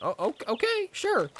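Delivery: high, stammering surrender; timid boyfriend. Pretend. Boyfriend yields to movie dad, high nervous assent. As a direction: give in with a squeaky little agreement.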